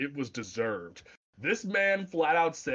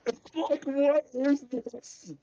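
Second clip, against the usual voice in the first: high, slow disbelief; baffled and amused; incredulous; 11 semitones higher, slower than usual. Real, not acted. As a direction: stretch it out in total disbelief. Incredulous about the Uzi cop.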